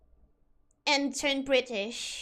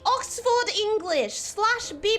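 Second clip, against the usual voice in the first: higher-pitched, indignant Queen's English; posh British scolding; 5 semitones higher than usual. Pretend. Streamer telling Benny to shut up, posh British. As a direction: tell someone off in exaggerated BBC English. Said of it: "I was explaining the Oxford English".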